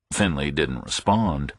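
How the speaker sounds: usual speaking voice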